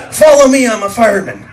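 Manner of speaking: high, loud order; commanding fireman. Retold or imitated. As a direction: take charge like a fireman. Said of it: "Uncle Rick says".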